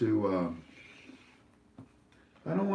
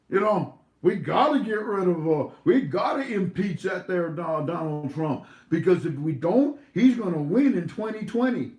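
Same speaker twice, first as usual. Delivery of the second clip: high, louder mocking impersonation; mock his alarm in a raised, exaggerated voice; sarcastic mockery; 6 semitones higher, 4 dB louder than usual. Host mimicking an interviewed politician demanding impeachment, mocking. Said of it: "That was mockery. Was that sarcasm?".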